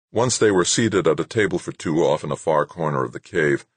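ordinary delivery, nothing marked

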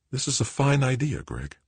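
happy. A narrator's reading. Joanna approving her son's idea, happy. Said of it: "Joanna smiled happily".

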